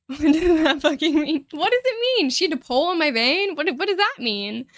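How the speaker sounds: exasperated disbelief. Real, not acted. Venting about the blood draw.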